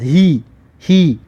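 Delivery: هی with a light plain h and long "ii"; h kept out of throat. Shown as a demonstration. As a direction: draw out هی slowly, no throat friction.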